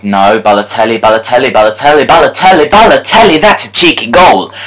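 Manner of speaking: rising groan as the other side scores; building disbelief at a goal. Real, not acted.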